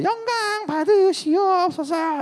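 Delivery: hammed-up solemn bow in a squeaky voice; bowing sheaf of grain. The bowing sheaf honours Joseph, high and slow. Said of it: "막 곡식 단이 절을 하는 거예요".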